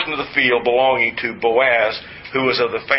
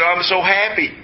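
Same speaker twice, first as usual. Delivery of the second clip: imitating an overjoyed woman in a higher voice; excited employee; 6 semitones higher than usual. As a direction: act out her happy outburst, pitched up. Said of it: "She's so excited".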